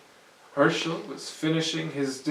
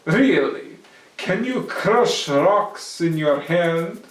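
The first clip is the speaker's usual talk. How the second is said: louder, slow teasing question; Herschel, teasing; 3 semitones higher, 8 dB louder, slower than usual. Acted. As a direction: dare the goblin. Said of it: "asked Herschel".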